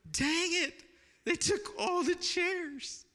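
high, slow, drawn-out mock whine; mock dismay. Pastor retelling his reaction as they leave: high, slow mock dismay. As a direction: play up the disappointment, way up high. Crowd leaves with church chairs.

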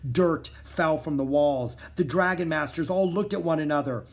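plain reading voice

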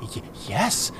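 breathy, shaking little yes; frightened whispered stammer. Acted. Captive whispers his answer to the assassin, frightened. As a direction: whisper it in fear.

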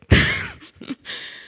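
squealing laugh at her own story; high burst of laughter. Real, not acted. Preacher laughing at her own anecdote, high burst. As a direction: let out a high, delighted laugh.

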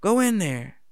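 high, loud call from the coach. Retold or imitated. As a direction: yell him into the game like a youth coach. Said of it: "I remember the coach said".

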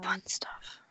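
dry whispered aside. Real, not acted. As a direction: whisper it sarcastically. Whispered repeat of own remark.

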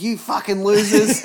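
loud, high, slow laughing yell; swearing tirade. Retold or imitated. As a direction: spit out the insult like a furious chef. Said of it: "and he's like".

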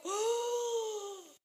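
high, loud cry of amazement; shocked oh. Real, not acted. Daughter sees her mother's flat belly, a loud high cry of shock. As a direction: let out a stunned oh. Reacting to mom's flat stomach.

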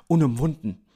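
usual speaking voice

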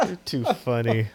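normal talking voice

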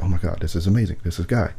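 hushed, low-pitched amazement; retold flabbergasted. Friend marvelling at his neighbour at communion, quietly flabbergasted. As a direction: murmur it softly, as if stunned.

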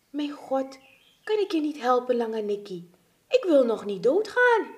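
high, mildly panicked plea; acted despair, rising in volume; desperate pleading. Wounded friends fear they will die.

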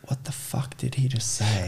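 whispered confused listener. Pretend. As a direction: whisper it in disbelief. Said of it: "listeners think".

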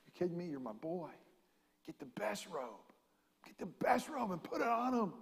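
soft, hushed fatherly welcome; father's joy. Acted. Father to his returned son, soft tender welcome.

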